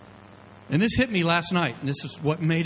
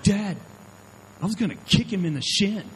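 put-on young son; 6 semitones higher than usual. Son answers his father.

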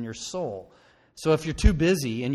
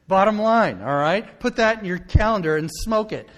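higher and louder, laughing; laughing emphatic declaration; 7 semitones higher, 10 dB louder than usual. Real, not acted. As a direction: push it home through the laugh. Preacher hammers point, laughing.

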